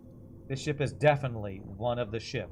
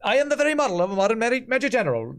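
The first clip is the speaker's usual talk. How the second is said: high, loud stagey mock British; hammed-up British operetta patter; bad British accent; 10 semitones higher, 6 dB louder, faster than usual. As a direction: imitate a student's overdone British accent. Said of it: "us trying to do a British accent".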